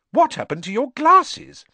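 high, loud startled question; surprised. A narrator's reading. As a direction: blurt it out in alarm. Hermione surprised at broken glasses.

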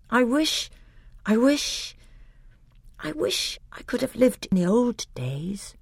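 put-on a boy half-whispering his longing; wistful boy. A narrator's reading. Caspian confides his wish to the king, hushed and boyish.

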